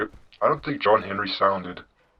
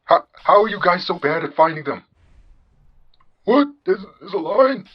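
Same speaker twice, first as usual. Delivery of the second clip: higher-pitched, baffled questioning; exasperated disbelief; 7 semitones higher, 4 dB louder than usual. Acted. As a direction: ask as if you cannot believe such incompetence. Host baffled by their incompetence.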